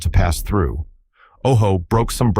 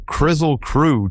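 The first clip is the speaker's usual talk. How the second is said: calling out; 8 semitones higher, slower than usual. Acted. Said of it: "they all cried".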